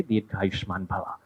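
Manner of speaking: soft, slow solemn blessing; hushed blessing. Acted. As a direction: breathe the blessing out slowly. Sages bless in one voice.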